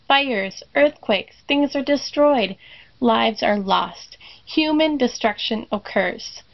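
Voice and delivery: ordinary talk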